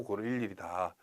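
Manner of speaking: usual speaking voice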